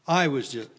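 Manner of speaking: usual speaking voice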